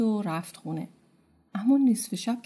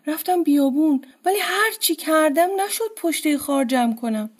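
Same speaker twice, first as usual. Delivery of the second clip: higher, louder weary reply; lightly voiced old man explaining he failed; a hint of a tired old husband, raised; old man answering; 6 semitones higher, 4 dB louder than usual. A narrator's reading. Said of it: "پیرمرد گفت".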